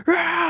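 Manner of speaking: short high-pitched mock scream; imitated scream. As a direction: mimic a man screaming in pain.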